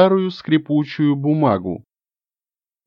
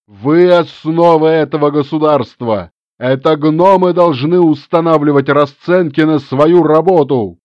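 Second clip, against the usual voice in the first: louder, fiery crowd speech; rabble-rousing shout; 2 semitones higher, 5 dB louder than usual. Acted. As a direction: whip the crowd up. (пламенная речь перед толпой)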